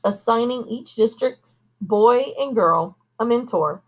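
ordinary delivery, nothing marked

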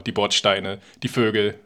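ordinary talk, nothing marked

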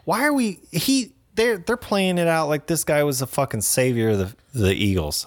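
high-pitched disbelief at the movie; retelling his own baffled reaction, voice climbing high; incredulous. Retold or imitated. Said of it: "I was like".

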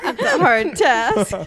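slow, laughing, high and loud. Real, not acted. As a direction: squeeze the words out through laughter. Laughing at the request.

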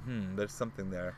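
low, soft pondering; musing to oneself. Retold or imitated. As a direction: quietly mull it over, low. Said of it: "I'm just like".

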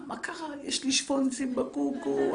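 put-on high, slow, whimpering child with a laugh; crying little girl. Daughter crying over a ribbon in her ponytail, mock whiny child. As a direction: whine it like a sulky nine-year-old.

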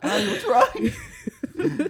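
very high, drawn-out mock wail; hammed-up panicked reply, loud; mock alarm. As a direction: answer a scream with an exaggerated shriek. Acts own alarmed reply to screams.

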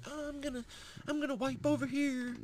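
high, soft put-on maid voice; mocking the maid. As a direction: squeak it out as the dainty maid. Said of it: "And she's just like".

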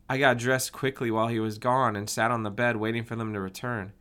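usual speaking voice

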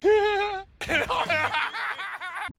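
real laugh. Real, not acted. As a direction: laugh at your own rant. Laughing after her rant.

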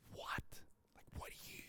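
hushed unvoiced whisper. Men in the cave whisper to David, hushed. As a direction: whisper so nobody in the cave hears. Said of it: "'cause they couldn't talk that loud".

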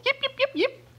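high yapping little dog; yappy chihuahua voice. Pretend. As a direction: yip it out like a tiny yappy dog. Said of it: "like a little yippy dog".

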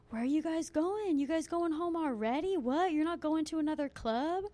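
mock a stranger trying his luck; imitating a pestering man. Voicing man approaching them.

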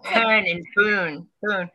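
mocking Baltimore vowels on "poon"; Baltimore accent. Shown as a demonstration. Showing friends how Baltimore locals talk, in a Baltimore accent. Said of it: "They say things like".

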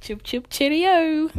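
high, drawn-out British sign-off; mock British accent. Co-host ending the banter, high mock British accent. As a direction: sing out a hammed-up English farewell. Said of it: "practice my British accent".

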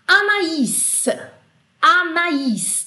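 "Anaís" split a-na-ÍS, stress on the end; French name Anaís. Shown as a demonstration. Teacher modelling "Anaís" for the class. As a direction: pronounce "Anaís" with separate A and I. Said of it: "Também a mesma coisa".

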